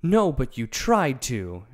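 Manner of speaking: Dorothy retorting to the lion. A narrator's reading. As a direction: snap back at him. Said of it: "she retorted".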